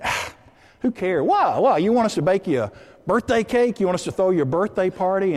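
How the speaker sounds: high, mocking string of questions; scoffing gal. Friend mocking the birthday girl's wish, scoffing. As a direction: scoff at her with swooping, incredulous pitch. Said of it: "one of the other gals says something like".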